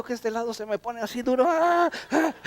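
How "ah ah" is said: loud, high mock cry of pain; short acted yelps as the body seizes up. Acts out one side stiffening up.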